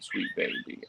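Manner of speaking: high, cooing pet voice. Real, not acted. Cooing to the cat.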